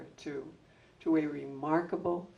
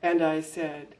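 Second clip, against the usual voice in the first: ordinary delivery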